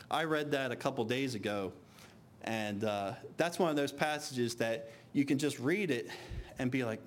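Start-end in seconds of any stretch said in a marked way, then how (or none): none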